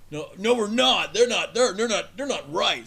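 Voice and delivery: drawn-out mock denial of the other group; smug denial. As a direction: deny it like a self-righteous member. Acting a smug group member.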